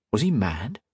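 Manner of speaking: ordinary delivery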